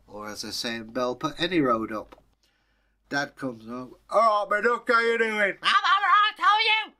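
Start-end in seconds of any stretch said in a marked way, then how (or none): 4.1-5.6 high, loud friendly greeting; a dad breezing in with a hello; cheery dad. Acted. Dad greets his wife, high and cheery. 5.6-6.9 very high, loud chirpy reply; a wife answering brightly; chirpy mother. Acted. Mother answers dad in a very high chirp.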